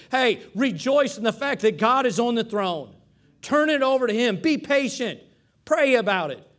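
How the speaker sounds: preaching at full force. Acted. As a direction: press the point home to the congregation.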